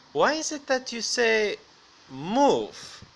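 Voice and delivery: high, loud quoted question; mock questioner. Quoting the man's question to him.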